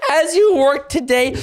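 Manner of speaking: reading through laughter; laughing while reading. Real, not acted. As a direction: giggle through the words. Reading classroom poster, laughing.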